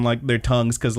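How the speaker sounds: ordinary talk, nothing marked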